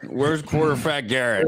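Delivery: put-on slurred drunk guy voice; drunk Kevin. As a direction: slur it like a drunk. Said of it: "That's drunk Kevin".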